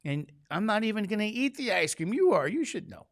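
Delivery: mock indignant husband; exasperated retort. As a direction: throw it back at her, fed up.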